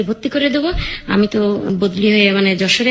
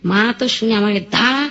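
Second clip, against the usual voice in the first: normal talking voice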